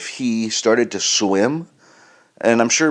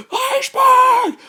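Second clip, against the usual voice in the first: panicked scream, much higher, drawn out slow; 3 dB louder, much slower than usual. Pretend. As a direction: scream it in sheer terror.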